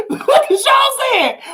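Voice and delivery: laughing and calling out to viewers; laughing shout. Real, not acted. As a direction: laugh it out at the chat.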